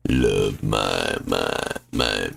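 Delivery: low, slow seductive voice; sexy voice. Pretend. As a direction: put on a deep sexy voice. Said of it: "I will voice the event".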